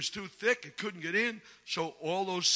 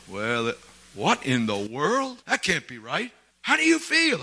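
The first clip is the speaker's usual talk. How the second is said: surprised doctor; 4 semitones lower, 3 dB louder than usual. Retold or imitated. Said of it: "And he goes".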